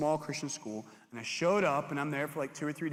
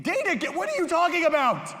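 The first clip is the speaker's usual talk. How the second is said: much higher and louder, disbelieving; high incredulous shout; 13 semitones higher, 8 dB louder than usual. Retold or imitated. Youth pastor reacting to the ex, high shocked shout. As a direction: yelp it in shock.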